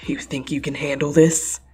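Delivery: a hint of a cocky villain; villain taunt. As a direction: taunt the hero smugly. Villain taunts during fight.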